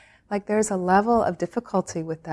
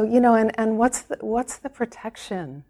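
ordinary talk

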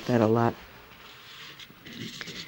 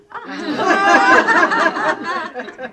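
real high laugh bursting out; burst of laughter; 14 semitones higher, 5 dB louder than usual. Real, not acted. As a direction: laugh out loud in surprised delight. Squirrel leaps up unexpectedly.

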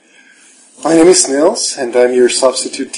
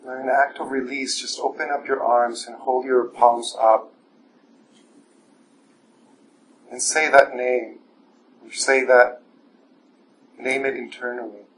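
guided meditation; 7 dB quieter than usual. Acted. Teacher guiding the evening class through standing meditation, soft and calm. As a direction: guide the room gently inward, unhurried.

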